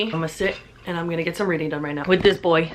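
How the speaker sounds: ordinary talk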